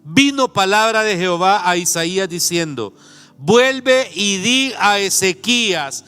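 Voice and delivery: loud, slow, high preaching of the text; solemn scripture reading. Acted. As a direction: proclaim the verses over the music. Scripture read with preaching force.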